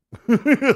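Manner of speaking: high burst of laughter; loud laugh. Real, not acted. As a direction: laugh out at your own silliness. Laughs at himself.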